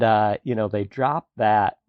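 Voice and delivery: ordinary talk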